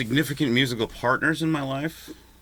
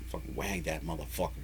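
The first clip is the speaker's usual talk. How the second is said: ordinary talk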